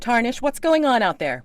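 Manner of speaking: high, loud call through the wagon wall; calling out from inside. Acted. Wagon owner calling to her hired man, high and loud. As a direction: call to him from inside, impatient.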